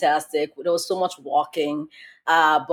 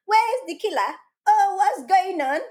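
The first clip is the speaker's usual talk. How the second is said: very high, airy put-on voice; mock clueless bystander; 13 semitones higher than usual. Mocking people who stand around during danger, high clueless voice.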